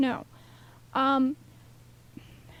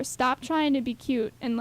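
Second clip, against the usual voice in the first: normal talking voice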